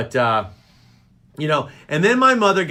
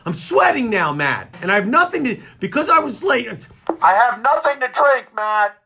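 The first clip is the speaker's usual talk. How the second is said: higher, agitated complaint; worked up; 6 semitones higher than usual. Real, not acted. Host complains to Matt about nothing to drink, worked up. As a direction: gripe with rising urgency. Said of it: "I get angry".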